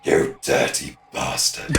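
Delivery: deep slow gruff taunt; low gruff voice. Acted. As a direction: growl it slowly, amused.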